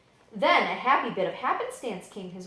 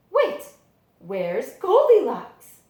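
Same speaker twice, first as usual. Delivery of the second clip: high, loud, drawn out; loud, slow startled question; surprised question; 5 semitones higher, 10 dB louder, much slower than usual. Acted. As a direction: stop short and ask where she is. Children notice a missing character.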